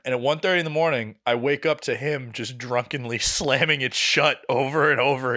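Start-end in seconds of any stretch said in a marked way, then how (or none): none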